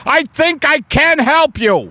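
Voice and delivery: high, eager would-be therapist; high-pitched amateur counsellor; guy through door. Acted. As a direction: call it helpfully through a closed door. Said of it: "a guy just, uh, like, through the door going".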